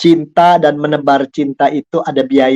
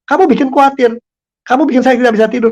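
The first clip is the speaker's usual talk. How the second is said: higher, accusing finger-pointing tone; wrong way: accusing the partner, pitched high; blaming, accusing; 9 semitones higher than usual. Shown as a demonstration. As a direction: snap the blame at your partner.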